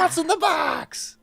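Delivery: very high, drawn-out catchphrase; theatrical falsetto cry; mock desperate wail. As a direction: wail it in hammed-up anguish. Host repeats catchphrase in mock desperation.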